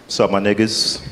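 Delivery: mock thug voice, pitched low; put-on tough greeting. Comic opening his set with a joke voice, mock tough. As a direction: drop it low for a joking street greeting. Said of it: "I don't talk like that".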